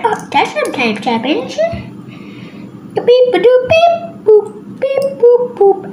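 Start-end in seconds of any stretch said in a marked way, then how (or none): 2.9-5.9 high, bouncy beep-boop noises; imitated video game beeps. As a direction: sing out little electronic blips on jumping pitches. Imitates game beeps as Super Mario.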